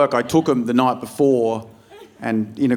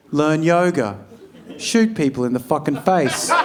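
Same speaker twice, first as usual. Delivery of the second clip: lifestyle ad read; 2 semitones higher than usual. Retold or imitated. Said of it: "They're like".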